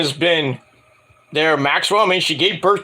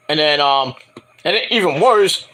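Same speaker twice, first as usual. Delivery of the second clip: usual speaking voice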